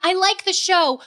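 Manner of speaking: high, loud screechy woman's voice; man doing a woman's voice. Real, not acted. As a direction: squeal it in a shrill lady voice. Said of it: "your woman voice talking is a little much".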